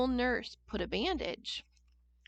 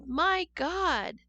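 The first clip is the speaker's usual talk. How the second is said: surprised exclaim; 6 semitones higher, 4 dB louder than usual. Shown as a demonstration. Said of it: "When I'm surprised or frightened".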